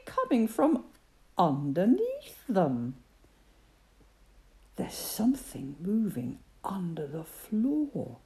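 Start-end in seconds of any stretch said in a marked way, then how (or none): 4.8-8.2 hushed whisper. Acted. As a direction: breathe it out in a whisper so nothing hears. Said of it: "whispered Percy".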